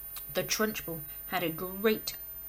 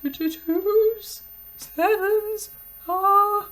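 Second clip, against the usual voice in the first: put-on high, slow strained child's gasp; 13 semitones higher, 12 dB louder, much slower than usual. Dangling Rupert gasps out the answer, high and slow. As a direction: force the words out breathless and scared. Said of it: "gasped Rupert".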